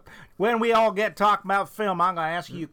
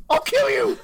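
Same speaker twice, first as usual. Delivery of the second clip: furious shouted threat; berserk rage; 8 dB louder than usual. Pretend. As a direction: scream it in blind fury. Enacted berserk superhero rage.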